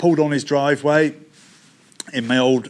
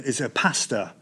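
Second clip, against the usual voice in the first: ordinary talk, nothing marked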